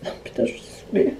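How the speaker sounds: under the breath, fed up; frustrated whisper. Real, not acted. Frustrated at her hair.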